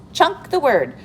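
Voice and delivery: a hint of a cartoon monkey; lightly voiced helper monkey. Teacher voices chunky monkey.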